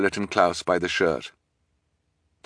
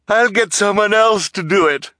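high, loud grand cry; 13 semitones higher, 6 dB louder than usual. A narrator's reading. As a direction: call out above the clamour. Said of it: "cried Esme Squalor".

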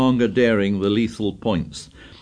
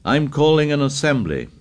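louder repeated call; 6 semitones higher than usual. Acted. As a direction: call it loudly, insisting. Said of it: "Ralph spoke again, loudly".

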